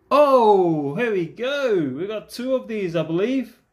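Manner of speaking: high, loud burst on "oh", then eager; thrilled, high-pitched reaction; excited find. Real, not acted. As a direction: exclaim with delight at a lucky find. Delighted at a card find.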